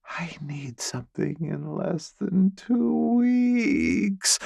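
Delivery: high whisper sliding into a moan. Acted. Elizabeth to the narrator, whisper turning into a quiet moan. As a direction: whisper it, then let it break into a moan. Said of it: "Her whisper turns into a quiet moan".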